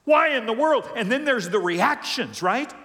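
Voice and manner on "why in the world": high, loud outcry; acted cry of disbelief; anguished protest. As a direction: demand an answer from God. Voices anguished questions to God.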